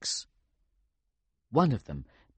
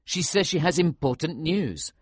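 arguing; 7 semitones higher, 5 dB louder than usual. A narrator's reading. Hero keeps arguing.

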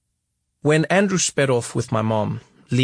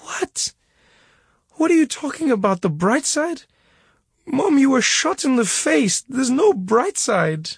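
tearful protest; 12 semitones higher than usual. A narrator's reading. Son crying in disbelief at his wounded mother.

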